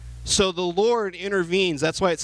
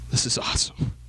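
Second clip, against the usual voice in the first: whisper. Real, not acted. Aside in a whisper.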